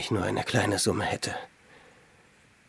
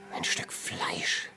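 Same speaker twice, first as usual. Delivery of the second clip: slow, voiceless whisper, much softer; slow hungry whisper; 4 semitones lower, 7 dB quieter, much slower than usual. Acted. Starving hermit slowly whispering of a piece of meat. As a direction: drag it out, dreaming of meat.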